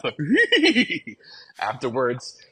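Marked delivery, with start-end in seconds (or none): 0.2-1.1 high, loud Scooby-Doo chuckle; Scooby's great laugh. Retold or imitated. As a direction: imitate the cartoon dog's giggle. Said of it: "Scooby does a great laugh".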